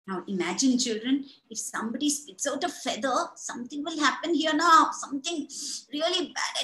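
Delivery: ordinary delivery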